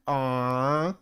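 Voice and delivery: normal talking voice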